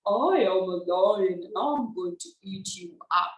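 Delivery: very low, swooping lion; deep storybook lion threat. Shown as a demonstration. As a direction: sink deep and swell like a lion. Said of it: "three different voices of the lion".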